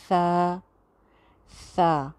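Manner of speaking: सा said as a lisped th, tongue between the teeth; correct tha. Shown as a demonstration. Teacher showing the right सा after the wrong one. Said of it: "और फिर बोलें".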